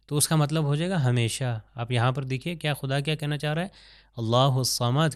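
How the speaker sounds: usual speaking voice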